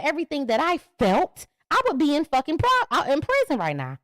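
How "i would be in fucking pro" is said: ordinary delivery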